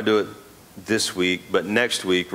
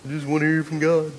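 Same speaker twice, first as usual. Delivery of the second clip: higher, whiny mocking imitation; mocking whine; 7 semitones higher than usual. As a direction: parody the lazy believer's sigh. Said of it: "Hear people say".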